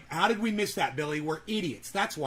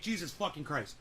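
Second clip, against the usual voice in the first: ordinary talk, nothing marked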